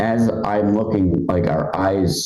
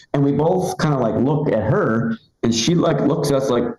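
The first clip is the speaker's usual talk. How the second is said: ordinary talk, nothing marked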